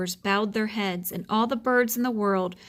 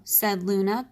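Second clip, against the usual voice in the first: usual speaking voice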